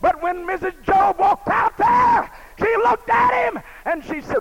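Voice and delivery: loud, high, impassioned preaching; preaching at full force. Acted. Preacher to his congregation, retelling Job, full-force preaching. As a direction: thunder it out to the congregation.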